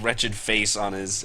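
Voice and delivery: ordinary delivery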